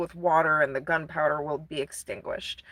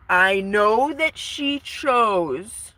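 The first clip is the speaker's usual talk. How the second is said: very slow, louder theatrical declaration; drawn-out mock drama; 3 semitones higher, 5 dB louder, much slower than usual. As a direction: stretch each word grandly, hammed up. Dramatic first take of Phantom's line.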